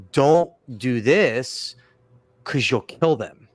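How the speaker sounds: very slow, deliberate warning; emphatic warning. Retold or imitated. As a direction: stretch out each word to warn them. Emphatic warning to those asking help.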